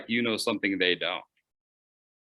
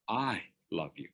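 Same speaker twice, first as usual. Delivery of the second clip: ordinary talk, nothing marked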